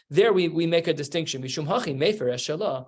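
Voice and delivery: ordinary talk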